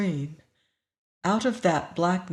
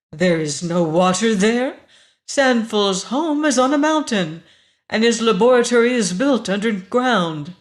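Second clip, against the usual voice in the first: loud, higher, ringing voice; 4 semitones higher, 7 dB louder than usual. A narrator's reading. Woman disputes the stranger's account of Sanfel's home, ringing. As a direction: protest so it rings out. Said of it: "The voice rang like a bell".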